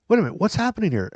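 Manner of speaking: high, quick bewildered question; retold bewilderment, pitched well up; startled confusion. Podcaster asking cleaner who woke him, startled and confused.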